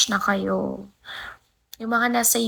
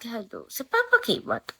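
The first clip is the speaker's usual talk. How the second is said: ordinary delivery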